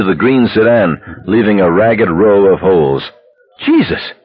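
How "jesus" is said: very high, shocked cry; alarmed scream. A narrator's reading. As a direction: scream it high without much volume. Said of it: "somebody screamed".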